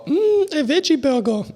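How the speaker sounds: high-pitched delighted food-unpacking impression; meme customer. Retelling a woman unpacking her order, high meme voice. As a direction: hum high, then name the food in a put-on voice. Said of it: "Und sie bestellt sich so".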